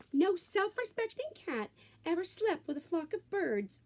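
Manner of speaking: high-pitched scolding mama; scolding mother. A narrator's reading. Mother scolding her son about birds, high voice. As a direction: scold him like an exasperated mother. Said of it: "she scolded".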